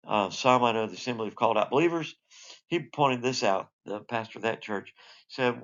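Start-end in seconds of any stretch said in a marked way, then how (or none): none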